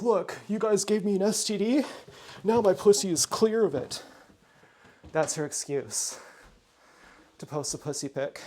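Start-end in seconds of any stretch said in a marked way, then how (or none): 0.0-4.0 put-on higher, louder quoted girl; mock declaration in a raised voice; imitating a girl. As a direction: pitch it up, loudly mimicking her announcement. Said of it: "She's like".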